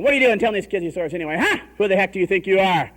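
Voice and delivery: loud, rapid-fire scolding; yelling grandpa. Retold or imitated. Grandpa scolds dad over his stories, yelling fast. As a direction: tell off your son as an irate grandpa. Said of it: "he just starts yelling at him".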